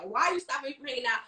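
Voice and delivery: ordinary talk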